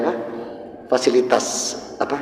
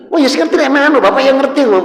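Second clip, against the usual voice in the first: high and loud, driving the point home; preaching emphasis; 11 semitones higher, 6 dB louder than usual. Acted. Preacher urges the congregation about old fathers, fervent. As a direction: raise it like a fervent sermon.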